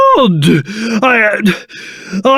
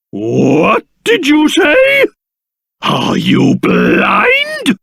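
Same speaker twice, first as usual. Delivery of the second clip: slow, indignant disbelief; affronted; 4 semitones higher, much slower than usual. Acted. King bristles at a slight on his appearance, affronted. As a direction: draw each word out in wounded pride. Offended at remark on his looks.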